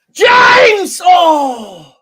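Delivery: high, loud cry falling away into a groan; excited shout. Real, not acted. Fan watching James shoot at goal, excited shout. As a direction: yell the name as the shot goes in. Shot at goal during match.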